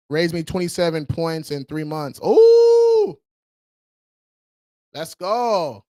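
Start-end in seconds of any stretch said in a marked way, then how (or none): 2.2-3.2 loud, high "ooh"; excited whoop. Real, not acted. Host reading a viewer's twenty-seven point gain, excited loud whoop. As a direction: hoot in celebration. Celebrating a credit score gain.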